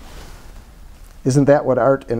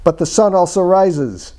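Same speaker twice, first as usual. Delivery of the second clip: raised, higher-pitched call; 6 semitones higher than usual. A narrator's reading. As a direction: call it after someone limping away. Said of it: "I called out".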